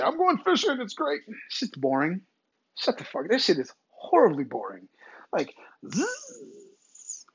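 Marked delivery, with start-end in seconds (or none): 0.0-1.2 much higher, put-on cheery voice; mock enthusiasm. Mocking people who love fishing, falsely thrilled. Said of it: "I like when people say". 5.9-7.2 soft high imitated zzz of a line; fishing reel whir. Imitating a boring fishing cast, thin buzzing noise.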